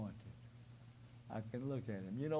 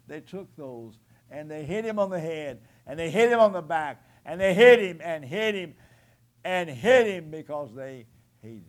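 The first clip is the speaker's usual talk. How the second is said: higher and louder with each hit him; building preaching shout; 3 semitones higher, 15 dB louder than usual. Real, not acted. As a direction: pound out every hit him at full force. Preacher building to climax on beating.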